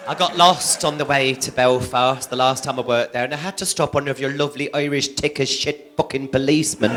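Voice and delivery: usual speaking voice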